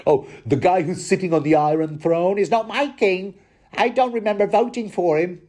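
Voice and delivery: lightly voiced high peasant grumble; a hint of a cheeky Holy Grail peasant; Monty Python peasant. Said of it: "sounds like out of Monty Python's The Holy Grail".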